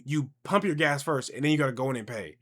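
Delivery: ordinary talk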